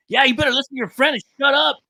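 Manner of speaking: high, loud mocking taunt; taunting gangster. Gangster taunts narrator to listen to his friend, high loud sneer. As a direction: sneer it down at him. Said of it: "he's like".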